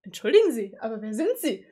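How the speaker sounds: low, stiff imitation of her; mock confused girl. Streamer voicing the heroine's reply, low mock. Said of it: "Und du bist nur so".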